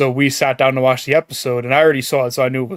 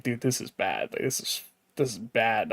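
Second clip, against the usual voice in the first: much softer, low, hushed dread; mock despairing murmur; 4 semitones lower, 13 dB quieter than usual. Fan retelling watching the sad scene, hushed and despairing. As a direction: mutter it like you can barely watch. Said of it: "I was like".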